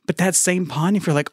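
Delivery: normal talking voice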